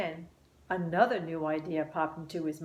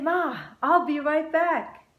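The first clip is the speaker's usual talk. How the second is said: calling out; 9 semitones higher, 6 dB louder, slower than usual. A narrator's reading. Child calling out to mom.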